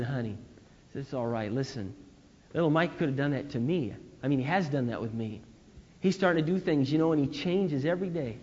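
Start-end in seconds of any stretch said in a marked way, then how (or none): 0.0-0.4 soft, tender "Honey"; gentle comfort. Retold or imitated. Father comforting his crying daughter, soft and tender. 1.0-8.4 softer, tender reassurance; calm her down gently; gentle comfort. Retold or imitated. Father reassuring daughter the baby is fine, soft and tender.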